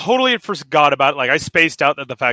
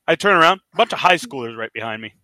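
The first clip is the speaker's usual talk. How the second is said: ordinary talk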